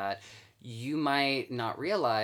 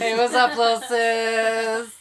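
laughing high echo; 11 semitones higher, 10 dB louder, slower than usual. Pretend.

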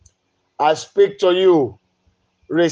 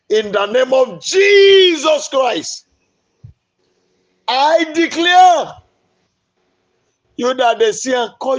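high, loud commanding shout; laying a curse; 5 semitones higher, 3 dB louder than usual. Real, not acted. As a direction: bark the curse like a fiery preacher. Preacher cursing cancer.

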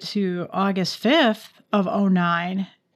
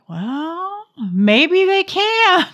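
much higher, slow and drawn out; high sarcastic drawl; 10 semitones higher, 4 dB louder, much slower than usual. Retold or imitated. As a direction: stretch it out way up high, teasing. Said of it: "And I'm like".

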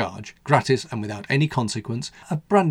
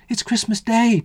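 put-on much higher, bright child voice; cheerily; 11 semitones higher, 3 dB louder, slower than usual. A narrator's reading. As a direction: chirp it out cheerfully. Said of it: "surprisingly cheerily given the hour".

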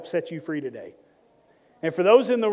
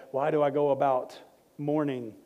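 low, softer mock-serious quote; mock everyday talk; 5 semitones lower, 5 dB quieter than usual. Pastor joking that nobody talks like that, mock-solemn quoting. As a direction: lightly send up the stiff old wording. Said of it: "I don't think we talk like that, like".

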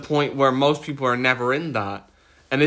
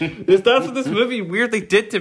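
laughing; 7 semitones higher, 3 dB louder than usual. Real, not acted. Laughs at own pretentiousness.

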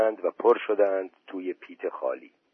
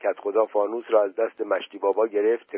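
ordinary delivery

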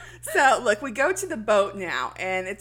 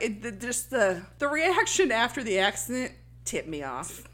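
ordinary delivery

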